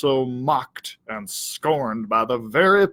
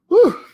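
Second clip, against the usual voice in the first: high, loud shaky exhale; dazed whew; 8 dB louder than usual. Acted. Stunned dog shaking off the crash, loud whew. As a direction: blow out air to clear your head.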